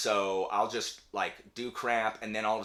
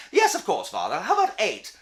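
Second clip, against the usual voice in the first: put-on eager, much higher, polite British child; 9 semitones higher, 8 dB louder than usual. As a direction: agree brightly like a well-mannered English child. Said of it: "Why are they British?".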